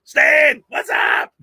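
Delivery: high, loud call-out to a viewer; excited greeting. Real, not acted. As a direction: sing out the name happily. Chat shout-out greeting.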